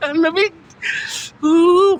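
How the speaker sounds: high, loud shivering voice. Real, not acted. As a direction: talk through chattering teeth.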